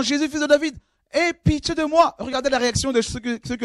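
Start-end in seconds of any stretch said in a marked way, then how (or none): 0.0-2.2 much higher pleading cry; blind man's cry. Acted. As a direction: call out high above the crowd. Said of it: "Alors il cria".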